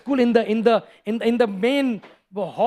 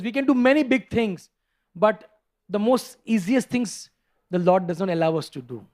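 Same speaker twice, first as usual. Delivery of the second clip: ordinary talk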